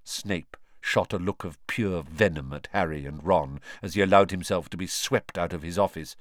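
ordinary delivery, nothing marked

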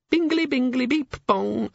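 very high, squeaky little chime; chirpy imp alarm. Acted. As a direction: sing out like a tiny talking alarm clock. Pocket imp imitates an alarm chime.

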